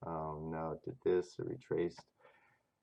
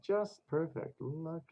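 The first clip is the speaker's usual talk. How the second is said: much higher, gleeful praise; delighted; 10 semitones higher than usual. Acted. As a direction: squeal it with delight. Delighted at chart, high take.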